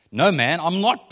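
a loud, quick denial; sharp retort. Acted. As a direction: throw the denial back at him. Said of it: "Peter retorted".

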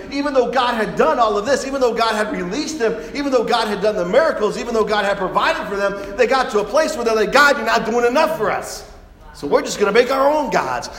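loud, high-pitched stubborn protest; acted-out indignant defiance; defiant complaint. Acting out stubborn, defiant people.

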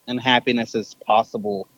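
ordinary delivery

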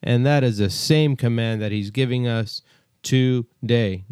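ordinary talk, nothing marked

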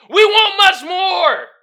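loud, slow shouted demand; emphatic shout. Real, not acted.

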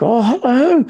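put-on high sing-song old lady greeting; hammed-up granny falsetto. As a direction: mimic a sweet older lady cooing hello. Mother-in-law voiced in story.

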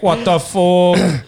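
loud, high, drawn-out disbelief; retold shock, played up big; shocked outburst. Retelling own shock at death.

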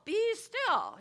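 sharp loud high shout of "Be still"; yelled. Shown as a demonstration. Parent yelling "Be still" at restless children, loud shout. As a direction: bark "Be still" like a fed-up parent. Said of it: "I don't think you would probably yell".

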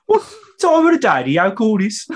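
very high, loud Brummie-Yorkshire indignation; muddled Brummie-Yorkshire accent. Acted. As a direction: berate him in a botched Brummie-Yorkshire voice. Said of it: "That was like a Brummie mix of a Yorkshireman".

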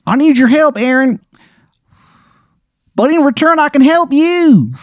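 put-on terrible Maryland accent. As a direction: ham up the worst Maryland accent imaginable. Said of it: "the worst Maryland accent you have ever heard".

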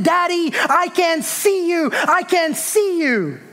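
put-on high, drawn-out plea of a frightened boy; boy's protest. Boy protesting to his dad from the roof, high drawn-out plea. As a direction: act out a child protesting, stretching the words high. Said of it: "the boy protested".

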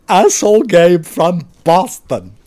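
slow, hammed-up Boston accent; put-on Boston accent, each word stretched. Shown as a demonstration. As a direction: sign off in drawn-out mock Bostonese. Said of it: "here is my best Boston accent".

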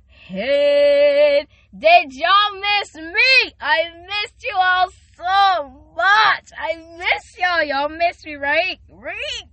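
loud, high, slow calling out; excited calling out. Real, not acted. Host calling out to listeners she missed, loud and high. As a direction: call to them like long-lost friends.

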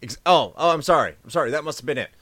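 loud mock sorry; sarcastic apology. Real, not acted. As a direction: fake an apology with heavy sarcasm.

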